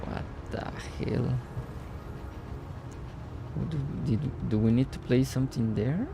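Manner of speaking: ordinary delivery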